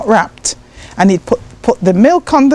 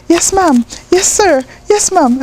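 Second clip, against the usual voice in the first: put-on polite American child; 7 semitones higher than usual. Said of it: "I was saying".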